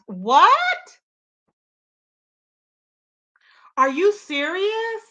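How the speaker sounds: loud "What?" then slow "Are you serious?"; stunned, slowed-down disbelief; shocked disbelief. Real, not acted. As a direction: react in real shock, then slow down on the question. Shocked by chat's claim.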